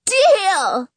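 high excited squeal. A narrator's reading. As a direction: squeal it out with glee. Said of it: "Jonah squeals".

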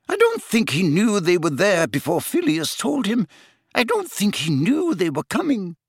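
high, agitated self-blame; distraught McGonagall. Acted. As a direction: blurt it out in a high, upset professor's voice. Narrator voices distraught McGonagall.

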